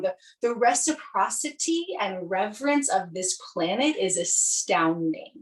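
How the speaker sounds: usual speaking voice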